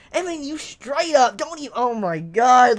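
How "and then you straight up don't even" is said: ordinary talk, nothing marked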